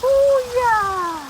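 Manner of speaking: long, very high drawn-out squeal; excited squeal. Real, not acted. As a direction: squeal with delight as the water comes. Delighted squeal at the water. (ખુશીની લાંબી ઊંચી ચીસ)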